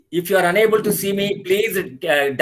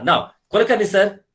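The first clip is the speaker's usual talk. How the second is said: ordinary talk, nothing marked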